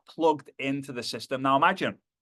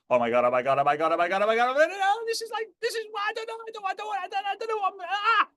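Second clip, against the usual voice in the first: babbled panic climbing to a very high squeal; mock panic; faster than usual. Terrified rider on the roller coaster, hammed-up rising panic. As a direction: spiral up into hysterical gabbling. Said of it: "two types of people on the roller coaster".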